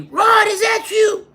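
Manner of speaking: very high, loud slow shout; drunk relative. Retold or imitated. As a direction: greet him like a tipsy uncle.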